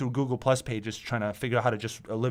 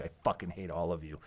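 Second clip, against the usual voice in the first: ordinary talk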